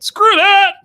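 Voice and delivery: loud, high-pitched mock yell; hammed-up dismissive shout; imitated dad's outburst. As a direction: blurt it out like a fed-up dad.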